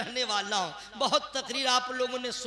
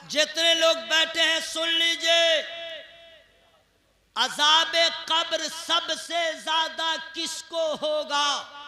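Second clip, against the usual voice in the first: loud, raised, drawn-out address; full-force preaching; 5 semitones higher, 7 dB louder, slower than usual. Acted. Preacher putting a question to everyone seated, full-force preaching. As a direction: call out slowly to the whole gathering.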